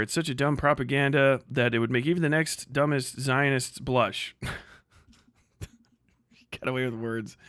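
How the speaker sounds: ordinary talk, nothing marked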